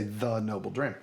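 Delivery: the with a short UH vowel; THE as "thuh". Shown as a demonstration. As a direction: say the as "thuh" before noble dram. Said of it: "That's why I say".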